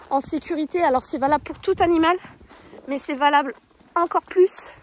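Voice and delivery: ordinary delivery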